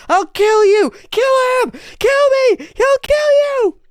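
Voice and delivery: shrieking, very high, loud; berserk rage. Acted. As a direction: shriek each line in frenzy. Escalating frenzied screams.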